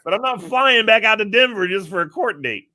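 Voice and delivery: high, loud protest broken by laughs; laughing protest. Retold or imitated. Driver laughing as he protests to the officer. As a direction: plead with the officer while cracking up.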